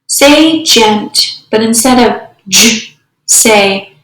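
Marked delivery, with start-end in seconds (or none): 2.5-3.0 "j" as a short "juh"; just the consonant sound "j"; isolated phoneme. Shown as a demonstration. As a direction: sound out "j", not "jay". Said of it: "substituting phonemes".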